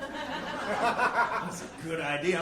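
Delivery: slow, laughing re-say of "That's a good idea."; laughing repeat. Real, not acted. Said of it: "repeats the line laughing".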